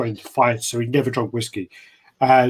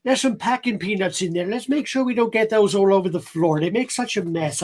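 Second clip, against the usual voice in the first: high, fussy bad American slipping into Irish; wobbly geeky American accent, nearly Irish; American turning Irish; 8 semitones higher than usual. Shown as a demonstration. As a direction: let a fake American accent drift Irish. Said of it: "you've gone Irish".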